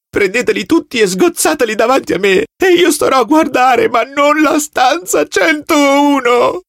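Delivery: terrified prisoner begging the officer; desperate begging. Acted. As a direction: plead wildly for mercy.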